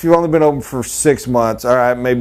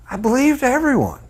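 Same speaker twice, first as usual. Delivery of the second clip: naive; 12 semitones higher than usual. Shown as a demonstration. Repeats line, second take marked.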